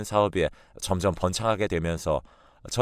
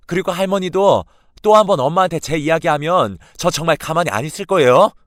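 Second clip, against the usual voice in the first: very loud, high, near-shouting outburst; furious granddaughter; 7 semitones higher, 8 dB louder than usual. Retold or imitated. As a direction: lay down the law to her grandmother. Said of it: "외할머니에게 크게 화를 내고". (할머니에게 퍼붓는 분노의 고함)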